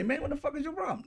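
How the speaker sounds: high, fast, half-swallowed mock outrage; mock exasperation. Neighbor states griping at Trump, high fast mock exasperation. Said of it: "get mad at him and say".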